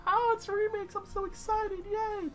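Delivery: very high, squealing mock-excited voice; mocking gushing fan. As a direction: parody a gullible overhyped fan. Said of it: "and everyone's like".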